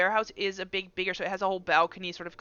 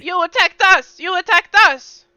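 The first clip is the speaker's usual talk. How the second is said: high, loud outraged yell; indignant shouting; 10 semitones higher, 13 dB louder than usual. Acted. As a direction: throw it back indignantly. NPC shouts back defensively.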